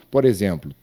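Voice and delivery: ordinary delivery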